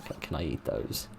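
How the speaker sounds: very low, barely audible begging; hushed pleading. Acted. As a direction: mumble the plea under your breath. Keeps begging under the breath.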